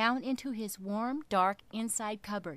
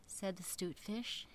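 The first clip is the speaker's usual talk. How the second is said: usual speaking voice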